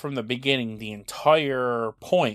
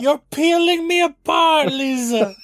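very high, loud hammed-up wail; mock anguished cry; 6 dB louder than usual. Co-host riffing on the peeled victim, hammed-up anguished cry. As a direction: cry it out in overblown melodrama. Co-host's mock movie-line outburst.

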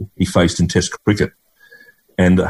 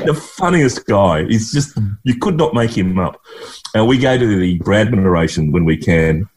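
ordinary talk, nothing marked

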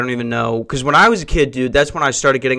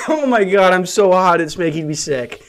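normal talking voice

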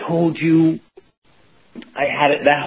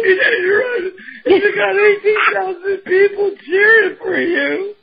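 mock crying niece. Continues the crying impression.

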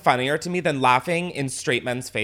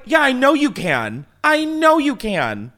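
high, slow patronising coo; mocking agreement; 10 semitones higher, 4 dB louder than usual. Laughing at the angry man's face, slow and condescending. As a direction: humour him like a toddler. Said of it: "I'm like".

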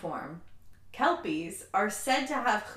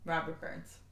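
ordinary talk, nothing marked

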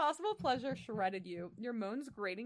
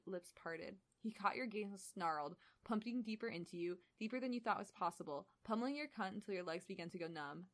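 ordinary talk, nothing marked

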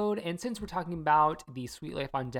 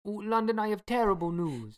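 lightly put-on Italian accent; a hint of Italian lover; 3 semitones higher than usual. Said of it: "my Italian accent".

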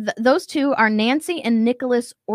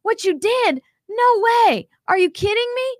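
high, excited disbelief; amazed disbelief; 9 semitones higher than usual. Retold or imitated. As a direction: squeal it out like you can't believe the news. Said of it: "And I was like".

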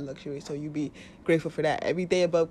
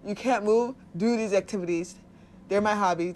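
ordinary talk

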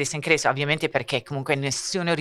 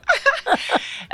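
high laugh. Real, not acted. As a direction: burst of high-pitched laughter. Laughs at own bad Greek. (risata acuta)

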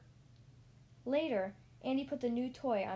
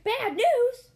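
much higher, much louder alarmed shout; 13 semitones higher, 14 dB louder than usual. Acted. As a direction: yell it out in shock. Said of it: "Slinky shouted".

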